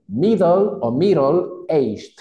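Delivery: "Middle" with a D, then "middle-aged" with a tapped R, very slowly; slow teacher demonstration of "middle-aged"; two pronunciations. Shown as a demonstration. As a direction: contrast D and flapped R in "middle". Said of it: "La D de dedo la puedo convertir en R".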